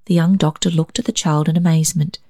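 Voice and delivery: ordinary delivery, nothing marked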